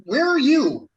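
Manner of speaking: high, loud hurried shout; urgent shout from the doorway; loud call. Acted. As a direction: call out while rushing in. Dave rushes out calling.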